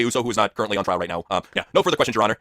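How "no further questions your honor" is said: ordinary talk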